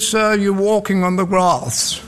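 lightly imitated Oxford accent; a touch of mock Oxford English. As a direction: put on a mild Oxford accent to mimic a reprimand. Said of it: "an Oxford accent came behind me".

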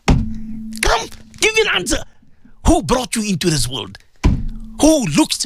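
normal talking voice

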